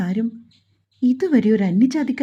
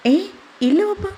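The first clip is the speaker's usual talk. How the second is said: high, caught-off-guard no; a son caught daydreaming, startled high reply; startled denial; 9 semitones higher than usual. Acted.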